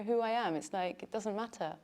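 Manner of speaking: ordinary delivery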